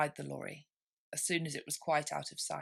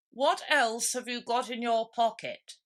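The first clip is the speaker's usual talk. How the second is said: slow, louder, higher dodo; 6 semitones higher, 6 dB louder than usual. A narrator's reading. Dodo turning to Alice, slow pompous call. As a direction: draw it out, pompous bird. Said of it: "he went on".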